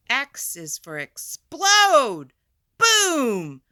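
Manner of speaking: loud, high explosive shout; big boom. Acted. Teacher shouting boom for the explosion page. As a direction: make the boom burst out.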